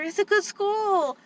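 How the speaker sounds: syrupy, drawn-out reassurance; mocking staff. Aunt mocking staff telling her sister, high and sugary. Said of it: "They're telling my sister".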